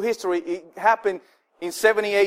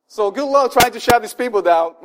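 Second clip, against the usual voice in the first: ordinary talk, nothing marked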